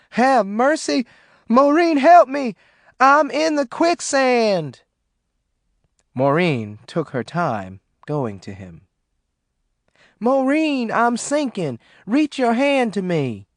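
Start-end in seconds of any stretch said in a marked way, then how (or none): 0.1-4.8 loud, high panicked calls for help; panicked scream. Acted. John screaming to Maureen from the quicksand. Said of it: "she heard him scream". 10.2-13.5 high frantic pleading; desperate cry. Acted. John crying up to Maureen as he sinks. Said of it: "John cried".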